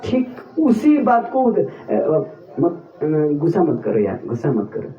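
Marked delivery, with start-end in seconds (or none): 1.6-4.9 mock soothing tease. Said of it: "क्या बोलने से इसको गुस्सा आएगा".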